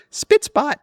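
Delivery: chirpy, very high-pitched catchphrase; high-pitched nanny impression; Mary Poppins. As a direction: sing out a bright little sign-off. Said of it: "like Mary Poppins does".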